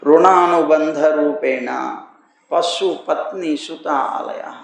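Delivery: slow, measured chanting of a Sanskrit verse; solemn scripture quote, drawn out; scripture recitation. Shown as a demonstration. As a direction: intone the verse slowly, word by word. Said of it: "శాస్త్రం ఎంత బాగా చెప్పింది చూడండి".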